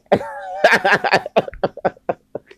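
big high cackling laugh; loud laugh. Real, not acted. Man laughing at his own boast, loud high cackle. Laughing after hyping himself.

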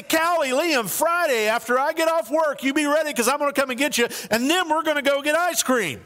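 father promising kids ice cream, lightly voiced. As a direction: rush the plan out to the kids.

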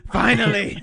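laughing punchline. Real, not acted.

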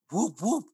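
high, loud siren whoops; imitated police siren. Cop car pulling up behind the cab, imitated siren whoops. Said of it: "you hear the cop sound".

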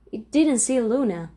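usual speaking voice

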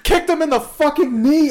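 normal talking voice

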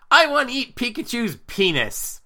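high, loud mock little-boy voice; imitated son. Son copying his friend's joke in the background, high kid imitation. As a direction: pipe it up like a boy showing off. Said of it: "I hear my son in the background".

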